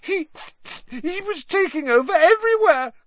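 very high, stammered, panicky gasp; squeaky breathless whine. A narrator's reading. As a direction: gasp out a frightened excuse. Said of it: "gasped Pettigrew".

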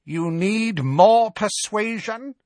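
high, slow, taunting witch voice; Bellatrix, menacing. A narrator's reading. Bellatrix taunting Harry, slow high menacing witch voice. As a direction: sneer the threat out slowly. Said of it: "she said, her chest rising and falling rapidly".

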